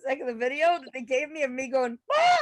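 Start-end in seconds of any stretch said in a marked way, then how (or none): 2.1-2.4 loud high panicked cries; terrified shrieks of Ma; screaming for mom. Retold or imitated. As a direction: yell for your mother in freefall. Video of her screaming in freefall.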